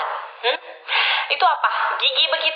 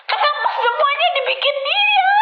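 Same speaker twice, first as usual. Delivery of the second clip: pitched way up in disbelief; a squeaky cry of disbelief; exasperated high whine. Real, not acted. Vlogger baffled by the animal filter, squeaky whine.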